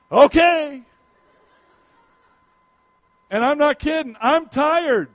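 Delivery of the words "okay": higher, louder weary agreement; mock game okay. Tired pastor agreeing to climb, mock bright okay. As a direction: play along, a bit hammed-up. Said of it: "I'm like".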